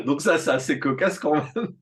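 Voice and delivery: laughing through the words; real amused laughing. Real, not acted. Guest telling host about schools that rejected then hired him, amused laughing. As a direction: let the laughter break into the line.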